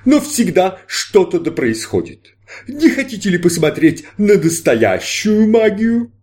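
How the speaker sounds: high, slow showman voice; grand stage magician, inviting. Acted. Magician narrated voice.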